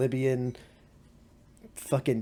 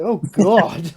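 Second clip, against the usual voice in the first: mock terrified cry; 5 semitones higher, 10 dB louder than usual. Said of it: "You're like".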